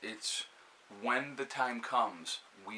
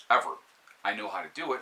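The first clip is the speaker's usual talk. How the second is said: normal talking voice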